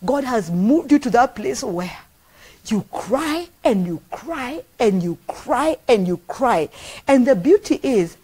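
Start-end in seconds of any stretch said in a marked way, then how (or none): 2.7-6.7 slowing, repeated cry drawn out; slow building repetition. Real, not acted. Preacher slowly repeating cry to congregation about weeping. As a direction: let each cry sink in slowly. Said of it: "preacher describing weeping".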